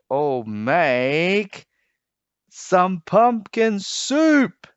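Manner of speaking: slow and rising to a high loud finish; drawn-out flourish. A narrator's reading. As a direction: stretch out pumpkin soup with glee. Storyteller's flourish on soup.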